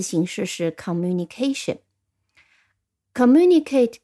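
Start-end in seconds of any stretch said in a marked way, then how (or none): 3.1-4.0 "Communicate" with MU punched higher and louder; stress on second syllable. Shown as a demonstration. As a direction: say "Communicate" leaning hard on the MU. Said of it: "它的重音在第二音节".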